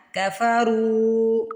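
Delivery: کفرو with a long drawn رو; stretched ru. Shown as a demonstration. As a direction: slowly pull out رو in کفرو. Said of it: "رو کو کھینچنا ہے". (کفرو میں رو کو کھینچ کر)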